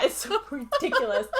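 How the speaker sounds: ordinary talk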